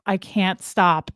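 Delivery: usual speaking voice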